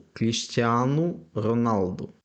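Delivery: Кріштіану Роналду drawn out slowly, final O as U; slow careful Portuguese. Shown as a demonstration. Teacher demonstrating final U sound, slow and careful. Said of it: "але має звук У".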